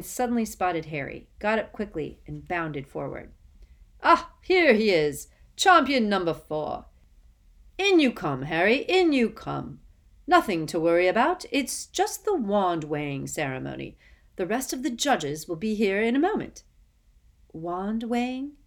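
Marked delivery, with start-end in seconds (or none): 4.0-16.6 much louder, booming welcome; hearty jovial host. Acted. Bagman greets Harry heartily. 17.5-18.5 nervous. Acted. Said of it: "Harry repeated nervously".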